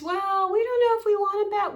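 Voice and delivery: high, hesitant mock of the parents; mock impression of dismissive parents; mock reluctant parents. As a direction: mimic their reluctance. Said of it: "their attitude is".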